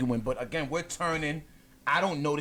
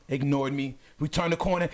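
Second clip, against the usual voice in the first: ordinary talk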